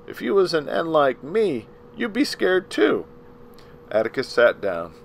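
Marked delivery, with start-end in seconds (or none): 0.1-3.0 scared plea. Acted. Tom pleads his fear to Atticus.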